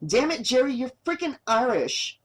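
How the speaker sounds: fed up, scolding him; frustrated. A narrator's reading. Carol scolds her cameraman in frustration, exasperated. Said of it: "she reminded him in frustration".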